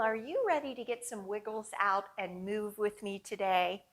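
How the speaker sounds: ordinary delivery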